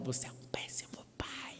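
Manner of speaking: hissed whisper, imitating an upset wife; whispered reproach. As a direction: whisper it as a cutting accusation. Wife reproaching him, acted. (sussurro de esposa indignada)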